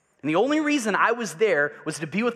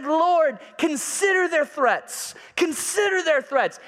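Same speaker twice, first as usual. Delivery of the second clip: high, slow, pleading prayer with a tremble of tears; earnest fervent prayer; 10 semitones higher, slower than usual. Retold or imitated. Preacher voicing the early church praying to God, high pleading prayer.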